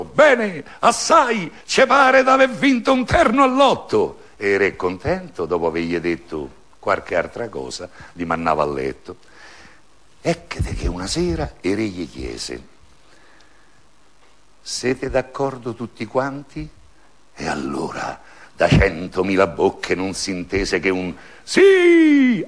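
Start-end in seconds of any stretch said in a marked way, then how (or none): 0.0-4.3 high, loud cheerful crowd reply in chorus; a whole crowd shouting back happily, pitched high. A narrator's reading. People below the palace answering their king, high and loud in chorus. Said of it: "gli rispondeva in coro". 21.4-22.4 very high, loud, stretched-out yes; long drawn-out yes. A narrator's reading. A hundred thousand mouths answering the king, long drawn-out high yes. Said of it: "allungato che durò mezz'ora".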